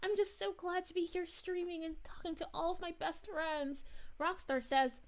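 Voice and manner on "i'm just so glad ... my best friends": usual speaking voice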